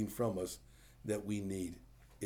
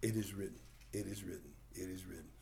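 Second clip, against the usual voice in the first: quiet; 4 semitones lower, 9 dB quieter, faster than usual. Retold or imitated. Jesus quoting scripture, fading.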